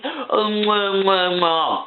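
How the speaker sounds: mwah kiss sounds, lightly mocking; mock kisses. Reviewer mocking the lovers with kiss noises. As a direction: smack mocking air kisses.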